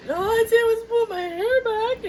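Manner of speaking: high laugh-cry quote; laughing protest. Pretend. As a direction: wail it through giggles.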